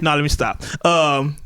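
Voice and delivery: ordinary talk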